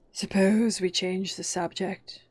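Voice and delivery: slow, drawn-out yawn. A narrator's reading. March Hare bored, yawning through the line.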